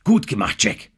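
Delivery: kurzer, lauter Zuruf; zurufen. A narrator's reading. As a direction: rufe ihm das Lob zu. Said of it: "rief Denham ihm zu". (lobender Zuruf, etwas höher)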